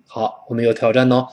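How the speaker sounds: ordinary talk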